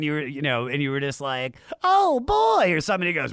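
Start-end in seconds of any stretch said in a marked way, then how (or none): 1.8-2.7 high, loud cartoon squeal; SpongeBob voice. Imitating SpongeBob to cops.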